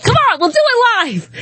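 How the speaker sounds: mock TV-anchor outburst, pitched high, "we'll do it live"; a light, hammed-up take on a famous anchor losing his cool; Bill O'Reilly impression. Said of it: "that Bill O'Reilly clip where he's like".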